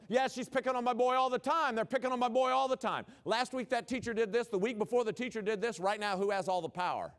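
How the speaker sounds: loud, high-pitched complaining parent; angry parent. Retold or imitated. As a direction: rant like a parent who's sure the teacher is picking on their boy.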